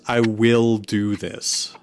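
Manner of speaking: ordinary delivery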